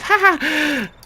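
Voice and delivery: high bright laugh; a high hearty mock laugh; character laugh. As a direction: toss off a light high laugh. Character laughs in voiced role.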